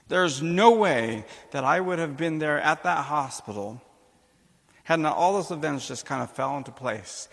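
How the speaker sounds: preaching emphasis. Real, not acted. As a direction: proclaim it like from the pulpit. Preaching climax about providence.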